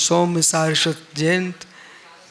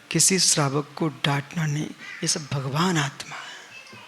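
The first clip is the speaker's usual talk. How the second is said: tender aside; 5 semitones lower, 6 dB quieter than usual. Real, not acted. Guru gently reminds the young monk that listeners are divine souls, tenderly.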